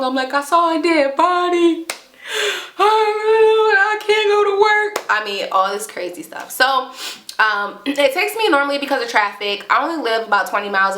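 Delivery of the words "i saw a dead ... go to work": high, drawn-out mock wail; still freaking out. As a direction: re-enact panicking to family, slowing into a wail. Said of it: "I'm still freaking out".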